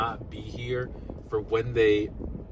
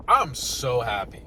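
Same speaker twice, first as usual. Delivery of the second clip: ordinary talk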